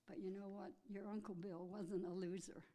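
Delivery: usual speaking voice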